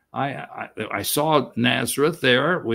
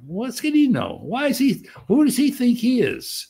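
higher, mocking incredulous voice; mock sneer; 10 semitones higher than usual. Relatives sneering at the one who got ahead, mock scorn. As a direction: play the scornful relative, pitched up.

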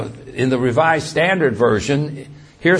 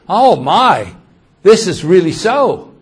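raised, louder voice of dawning alarm; alarmed realization; 6 semitones higher, 7 dB louder than usual. Acted. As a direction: act out sudden mild panic. Acting disciple's alarm at armed men.

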